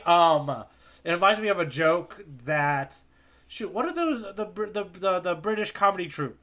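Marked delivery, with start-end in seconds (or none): none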